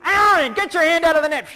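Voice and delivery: loud, high bossy shout; mother's retold yell. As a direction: imitate a commanding mom on the sideline. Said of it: "yells out".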